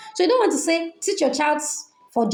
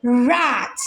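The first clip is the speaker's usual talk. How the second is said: "Rat" with the r punched louder than the rest; louder first sound; 3 dB louder than usual. Shown as a demonstration. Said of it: "the first sound needs to be louder".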